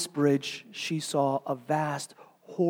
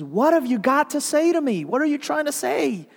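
high, loud, affronted demand; a proud churchwoman demanding an answer; indignant outburst; 12 semitones higher, 9 dB louder than usual. Acted. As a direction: confront her, high and indignant. Mrs Turpin confronting Mary Grace.

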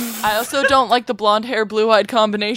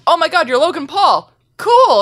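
excited fangirl; 8 semitones higher, 7 dB louder than usual. Retold or imitated. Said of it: "was like".

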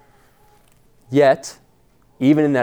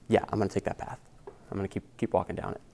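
much softer, offhand imagined reply; quiet mock resolve; 10 dB quieter, faster than usual. Preacher imagining someone knowingly choosing the path to destruction, quiet and offhand. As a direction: drop the volume, as if casually agreeing.

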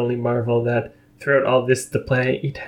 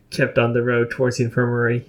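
ordinary delivery, nothing marked

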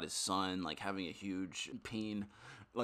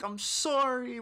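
much higher, louder distressed apology; mock pleading apology; 8 dB louder, much slower than usual. Wishing to apologise to the insulted passenger, high pleading.

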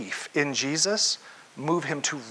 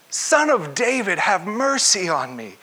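crying out all the more; 3 semitones higher than usual. Acted. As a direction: call out the plea, stretching the end. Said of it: "he cried out all the more".

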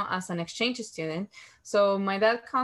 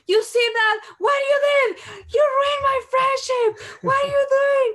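much higher and louder, furious crying shout; angry tearful yell; 6 dB louder than usual. Retold or imitated. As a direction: scream at him, voice breaking into tears. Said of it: "I was so crying, upset".

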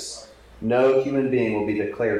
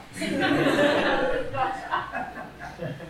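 amused laugh; 4 dB quieter than usual. Real, not acted. Preacher laughs after telling congregation his daughter's plan, amused laugh. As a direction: laugh out at the punchline.

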